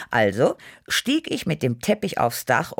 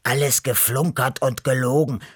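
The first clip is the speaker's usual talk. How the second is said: mildly indignant protest in a put-on cat voice; lightly voiced objecting cat. A narrator's reading. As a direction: protest it with a touch of grumpiness. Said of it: "protestierte der graue Beppo".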